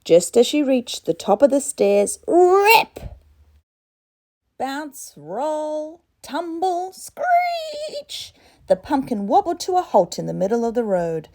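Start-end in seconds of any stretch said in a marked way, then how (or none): 2.3-6.9 sound effect. A narrator's reading. Sound word in story. 6.9-8.3 sound effect. A narrator's reading. Sound word in story.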